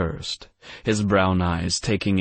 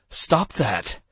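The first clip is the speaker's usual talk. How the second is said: higher alarmed cry; alarmed shout; 6 semitones higher than usual. Acted. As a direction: yell to stop him in a panic. Grabbing wheel in alarm.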